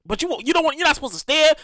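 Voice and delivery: usual speaking voice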